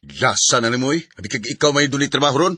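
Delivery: loud, high, defensive outburst; angry shout. Acted. Jobless husband yelling at his wife for nagging him. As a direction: snap back at her, stung and furious.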